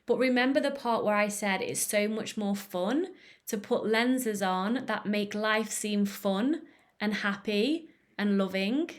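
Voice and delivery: normal talking voice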